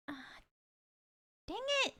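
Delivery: high frustrated yelp. Real, not acted. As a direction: yelp it in annoyance. Failed drink.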